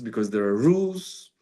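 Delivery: usual speaking voice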